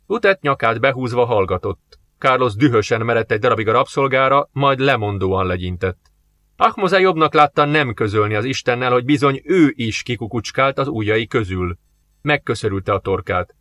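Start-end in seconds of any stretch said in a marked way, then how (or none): none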